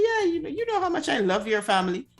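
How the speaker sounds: passive-aggressive fake keenness; sarcastic. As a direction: gush insincerely about loving the family. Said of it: "When someone is being sarcastic".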